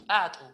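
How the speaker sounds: "Patu" with a popped ejective P; sharp glottal burst on the P of "Patu"; glottalized P. Shown as a demonstration. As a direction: press the lips, then pop "Patu" out. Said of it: "toman una presión".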